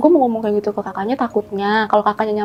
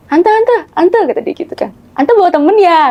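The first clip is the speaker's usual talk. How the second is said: put-on child voice; 6 semitones higher, 3 dB louder than usual. Ponakan kecil memanggil tante.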